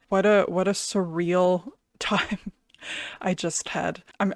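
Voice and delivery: normal talking voice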